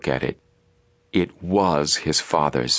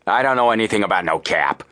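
stubborn; 3 semitones higher than usual. A narrator's reading. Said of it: "persisted the man".

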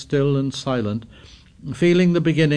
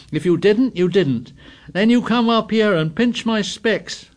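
louder, higher sneering retort; angry retort; 10 semitones higher, 5 dB louder than usual. A narrator's reading. Jack retorting to Piggy, angry and raised. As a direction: snap back at him.

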